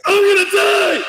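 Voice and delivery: a high, loud mock cry of I'm gonna die; mock panicked scream. Streamer voicing the old man's panic as a scream. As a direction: wail like the old man is doomed. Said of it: "Him like".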